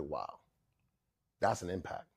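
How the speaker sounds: low, soft realization; understated low awe; quietly moved. Retold or imitated. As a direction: let it sink in, hushed. Recalls being moved by her tears.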